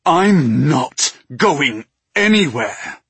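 loud, slow, defiant fury; furious. Acted. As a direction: answer her fury with defiance. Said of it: "his fury matching hers".

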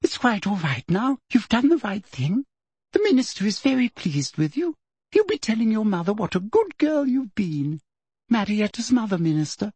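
high, sweet, soothing voice; soft coaxing. A narrator's reading. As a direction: coax her along sweetly. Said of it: "said Professor Umbridge softly".

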